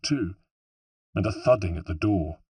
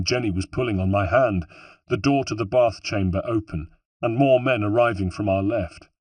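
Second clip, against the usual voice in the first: plain reading voice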